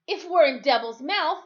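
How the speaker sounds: high, loud angry demand; boy shouting at Timothy in anger. Acted. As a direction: blame him and shout it. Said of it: "I asked angrily".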